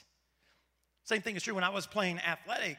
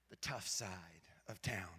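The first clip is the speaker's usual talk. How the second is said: hushed conspiratorial whisper, much lower; mock whisper; 13 semitones lower, 12 dB quieter than usual. Pastor confides to congregation about his neighborhood, playful whisper. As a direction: whisper it like a secret.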